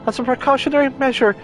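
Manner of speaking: very high, chirpy rabbit voice; Loporrit voice. Acted. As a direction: squeak it out like a bossy little bunny. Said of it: "I don't want to do the flippin' Loporrit voice".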